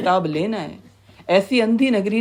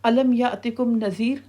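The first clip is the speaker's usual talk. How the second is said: slow, higher-pitched Arabic recitation; keeper's recited question; 4 semitones higher, slower than usual. Acted. Keeper of Hell questioning newcomers, slow recited challenge. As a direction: recite it slowly as a rising question. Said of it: "پھر وہ کیا کہے گا؟".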